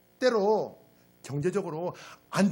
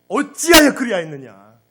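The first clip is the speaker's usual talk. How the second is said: much louder, wailing accusation; anguished reproach; 2 semitones higher, 16 dB louder than usual. Acted. God confronting wayward Israel, loud anguished reproach. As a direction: cry it out as a wounded God. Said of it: "하나님이 이 심정을 한번 느껴보세요".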